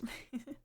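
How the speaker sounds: quiet breathy giggle; a small shy laugh under the breath; soft giggle. Acted. Mother giggling softly at her own wistful remark.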